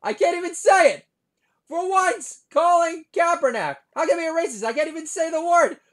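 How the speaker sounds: very high, loud squealing panic; mock frustration. Comic stuck on a word, high loud mock panic. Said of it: "I'm stuttering".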